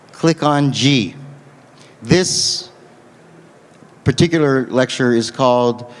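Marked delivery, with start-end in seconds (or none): 0.1-1.1 slow, spaced-out instruction; slow dictation. Real, not acted. Lecturer repeats website directions to the audience, slow dictation. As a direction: give it at note-taking speed.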